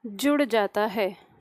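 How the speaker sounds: slow dictation with long pauses between phrases. Acted. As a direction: dictate word by word for note-takers, drawing out each sound. Dictates definition to class. (धीमे-धीमे, रुक-रुक कर श्रुतलेख)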